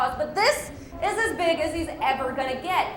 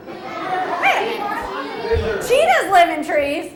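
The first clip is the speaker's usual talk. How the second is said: hammed-up astonishment, pitched up; exaggerated, high-pitched double take; mock disbelief; 6 semitones higher, 4 dB louder, much slower than usual. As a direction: play shocked at a child's wild guess. Mock disbelief at children's guesses.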